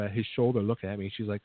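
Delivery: ordinary delivery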